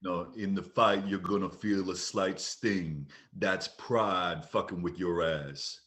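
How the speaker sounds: low, slow, menacing impression; Ving Rhames. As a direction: lay it down deep and deliberate. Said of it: "you want me to be Ving Rhames?".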